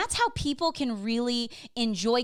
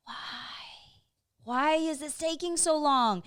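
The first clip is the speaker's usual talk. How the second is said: hammed-up impatient whine; mock exasperation; 5 semitones higher than usual. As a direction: stretch it out like a silent wail of impatience. Retells inner impatience in meetings.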